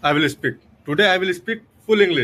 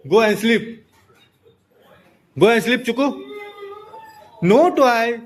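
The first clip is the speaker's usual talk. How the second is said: stern higher voice; 7 semitones higher than usual. Real, not acted. Sending nephew to bed.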